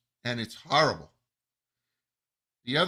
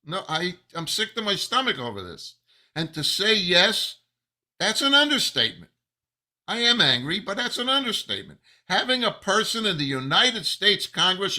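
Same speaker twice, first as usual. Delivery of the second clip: real anger, higher and louder; angry; 6 semitones higher, 3 dB louder than usual. Real, not acted. Venting to viewers in real anger, higher and louder. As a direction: voice rising in open outrage. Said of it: "If I sound angry, I am".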